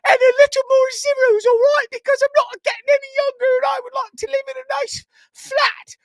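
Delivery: very high, loud, shrill-pitched mother scolding; over-the-top high mom voice, half-shouted; nagging mom voice. Retold or imitated. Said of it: "that voice".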